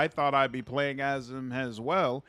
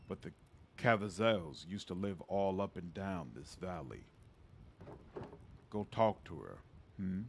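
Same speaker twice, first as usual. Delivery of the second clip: put-on old father voice; 6 semitones lower, 8 dB quieter than usual. Father figure in game dialogue.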